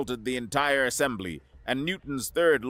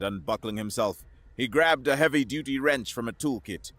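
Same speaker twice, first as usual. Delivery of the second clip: plain reading voice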